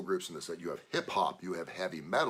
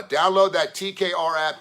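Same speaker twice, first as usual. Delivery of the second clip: plain; 8 semitones higher, 9 dB louder than usual. Real, not acted. Promo plug for the app.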